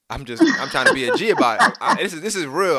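laughing through the words; can't help laughing while telling it. Real, not acted.

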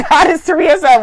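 ordinary talk, nothing marked

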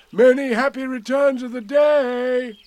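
high, loud, cheery call. A narrator's reading. Pooh greeting Eeyore from across the river, calling out. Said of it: "called out Pooh".

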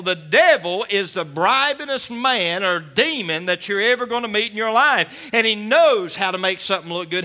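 loud, high, fervent preacher's cry; full-force preaching. Acted. Preacher exhorting the congregation, loud and fervent.

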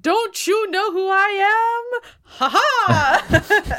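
high, loud mock grandiose villain; hammed-up boast with a theatrical laugh; boastful villain. Dutchman taunting Senta, hammed-up boasting. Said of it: "and then he's like".

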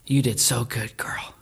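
tender hushed praise to a dead companion; whispered comfort. Retold or imitated. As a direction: breathe it gently, no voice. Boy comforts his dead dog.

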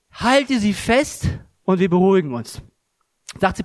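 ordinary talk

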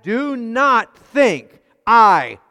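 "do not think I" very slow and loud, every word hit; weighty, spaced-out stress on "do not think I"; emphatic DO NOT. Acted. Preacher stressing Jesus's command in the verse, emphatic "do not think I". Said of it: "it's an emphatic".

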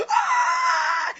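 high screeching yell; wild yell, way up high; furious yell. Retold or imitated. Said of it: "He was just yelling".

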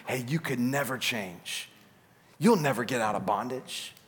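low, soft voice of the tempter; insinuating discouragement. Acted. Voices the enemy's discouraging lies.

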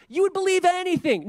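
high, loud mocking scoff. Preacher mocks the idea that ancients were gullible, scoffing.

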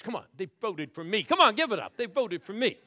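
loud, high hype to the crowd; rally the room to cheer; excited call out. Real, not acted. Crowd hyped, calling out.

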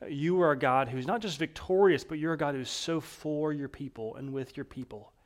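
hushed closing prayer, softening at the end. Real, not acted. Pastor praying over the congregation, hushed. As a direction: pray it gently to God.

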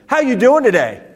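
high, loud "How you doing today"; exuberant greeting. Shown as a demonstration. Coach demonstrates tone of voice, exuberant. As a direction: burst out with a big, high greeting. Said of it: "I just said the same phrase five different ways".

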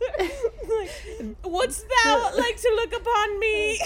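put-on high, laughing old-English flutter; flustered Winifred. Host imitating Winifred flattered by fans, high and laughing. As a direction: swoon through the archaic words, giggling. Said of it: "Winnie's like".

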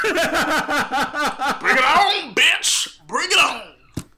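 loud, laughing dare; hammed-up bring it on; mock tough shout. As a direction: shout the dare, half joking. Mock challenge to be punched.

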